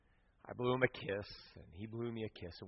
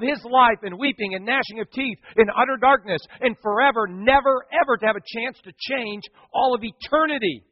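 higher, loud impassioned pleading; fervent frustration; 7 semitones higher, 4 dB louder than usual. Retold or imitated. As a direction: pour out the frustration. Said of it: "God still hadn't answered my prayer".

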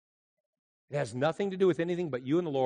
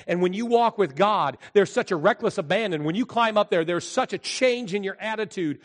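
rising, louder preacher voice; preaching; 6 semitones higher, 7 dB louder than usual. Acted. Pastor preaching about Everest and God, building louder. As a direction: build it up to a sermon.

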